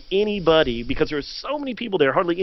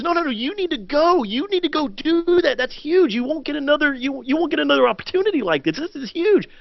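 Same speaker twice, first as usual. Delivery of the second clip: much higher, insistent voice of the wife; 10 semitones higher than usual. Retold or imitated. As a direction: push him out the door in her voice.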